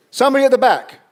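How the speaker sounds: loud, high call to the back of the room. Real, not acted. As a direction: project it to the back row. Calling out to the back row.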